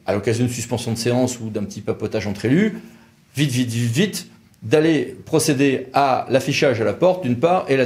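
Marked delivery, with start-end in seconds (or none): none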